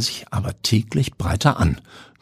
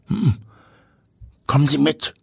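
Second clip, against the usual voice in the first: put-on old man voice; 7 semitones higher than usual. A narrator's reading. Old man invites Timo in.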